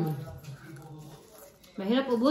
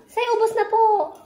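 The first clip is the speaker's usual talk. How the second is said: put-on pitched up, coaxing the child; high coaxing prompt; 10 semitones higher, 5 dB louder than usual. Shown as a demonstration. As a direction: sing it up high to coax. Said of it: "Jake, say".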